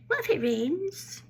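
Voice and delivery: high, drawn-out fretting question; slow it down and swoop up like a worried relative; mock interrogation. Relatives quizzing her about going away, high mock interrogation. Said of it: "I got the twenty questions ordeal".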